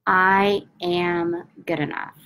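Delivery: slow, calm affirmation with long pauses; measured, soothing repetition; slow guided affirmation. Acted. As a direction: lead them through the tapping mantra gently. Tapping affirmation script, long pauses.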